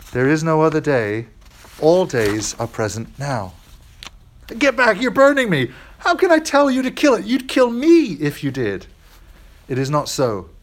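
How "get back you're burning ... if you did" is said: high, strained voice of a panicking ghost; frightened ghost. Acted. Ghost recoiling from the burning angel, high and frightened. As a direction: cry out as a terrified ghost, pitched up.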